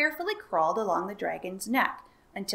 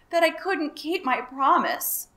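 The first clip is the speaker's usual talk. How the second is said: huffing apology; 7 semitones higher, 6 dB louder than usual. A narrator's reading. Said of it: "huffed the dragon".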